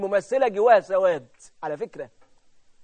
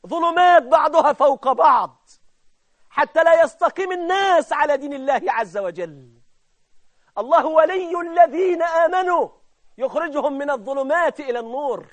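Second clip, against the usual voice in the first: high, ringing, measured declamation; raised pulpit voice with long vowels; impassioned preaching; 7 semitones higher, 7 dB louder than usual. Real, not acted. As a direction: proclaim it to the congregation. Preaches at full force.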